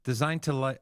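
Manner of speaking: ordinary delivery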